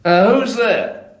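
slow, loud, fearful call into the dark; slow frightened shout. Acted. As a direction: shout down, scared of the answer. Calling into dark cellar.

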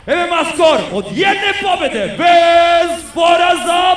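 slow build to a high, drawn-out name call; building announcer shout. Acted. Announcer presenting Milan to the crowd, building shout. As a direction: stretch the name out for the arena.

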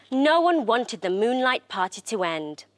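ordinary delivery, nothing marked

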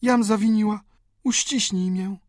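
high, emotional woman's voice; high-pitched remorseful appeal; repentant sister. A narrator's reading. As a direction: confess and plead in a high voice. Sister repents, asks to be embraced.

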